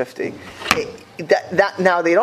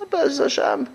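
naive son-in-law; 9 semitones higher than usual. Retold or imitated. Son-in-law answer in joke.

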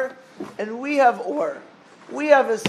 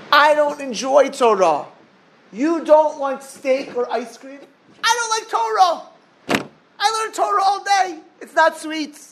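high, earnest complaint; plaintive boy; 8 semitones higher, slower than usual. Acted. Yeshiva boy admitting to the sage that Torah isn't sweet, high and plaintive. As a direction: confess it like a frustrated kid.

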